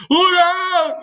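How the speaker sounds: high, loud alarmed cry; frightened cry. Acted. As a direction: warn everyone in a panic. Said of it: "they called".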